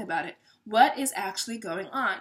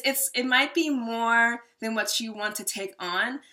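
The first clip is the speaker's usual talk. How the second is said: normal talking voice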